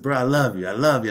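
loud, high, teasing bro I love you; laughing love declaration. Retold or imitated. To the man who tried to fight him, loud teasing affection. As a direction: shout it at him with a grin.

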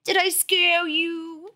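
put-on high, drawn-out babyish taunt; squeaky, slow small-boy voice; younger brother voice. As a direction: tease like a little kid. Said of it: "This is his voice".

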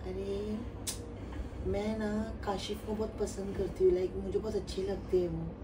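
put-on soft girly voice, fan calling; a man playing a shy girl; man doing a woman's voice. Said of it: "मैं ना आयशा बोल रही हूँ".

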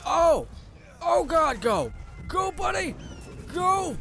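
high, loud frantic yelling to run; panicked shout. Real, not acted. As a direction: scream at the pet to flee.

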